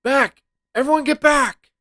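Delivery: high, loud shout to push everyone back; urgent warning shout. Acted. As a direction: yell to clear the area. Warning kids of danger.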